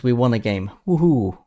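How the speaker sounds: usual speaking voice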